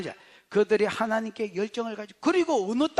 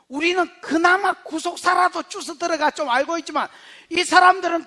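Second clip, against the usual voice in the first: loud, raised, impassioned preaching; full-force preaching; 7 semitones higher, 10 dB louder than usual. Real, not acted. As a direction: build to a forceful, high-pitched proclamation. (목소리 높여 힘차게 설교)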